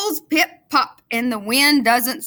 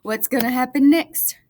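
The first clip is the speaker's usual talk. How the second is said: plain reading voice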